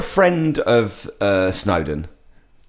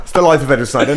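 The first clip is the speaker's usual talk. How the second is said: ordinary talk, nothing marked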